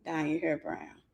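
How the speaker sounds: low, soft creepy echo of her words. Retold or imitated. Perv repeating her words, low and hushed.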